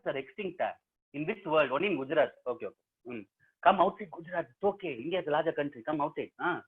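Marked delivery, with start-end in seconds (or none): none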